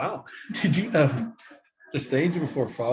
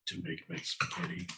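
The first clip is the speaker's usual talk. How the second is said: Clint Eastwood; 7 semitones lower, 8 dB quieter than usual. Pretend. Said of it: "my Clint Eastwood face".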